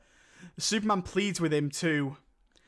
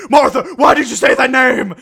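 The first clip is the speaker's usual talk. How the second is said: sudden high, very loud outburst; mock screaming; 7 semitones higher, 14 dB louder than usual. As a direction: explode in hammed-up outrage. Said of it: "he's just screaming".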